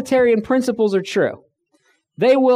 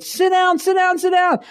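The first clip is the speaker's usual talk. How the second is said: retold pilot yelling; 11 semitones higher than usual. Pilot yelling at passengers to sit down, shouting. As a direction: bark it like a panicked pilot. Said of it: "the pilot started screaming and yelling at us".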